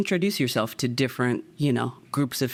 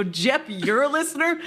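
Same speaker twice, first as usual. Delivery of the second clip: laughing surprise; 13 semitones higher than usual. Retold or imitated. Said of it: "I go".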